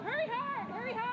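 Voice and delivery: high urgent yell to sweep; curling skip yelling. Real, not acted. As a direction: shout at the sweepers.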